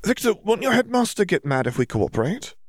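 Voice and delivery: high, anxious question; Hermione worried. A narrator's reading. Hermione asking Victor about his headmaster, high and worried. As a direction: press him with concern.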